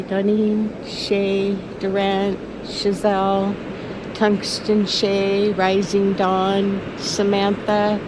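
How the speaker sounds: names drawn out slowly, one by one; slow naming. Real, not acted. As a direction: savour each name slowly. Listing her self-given names.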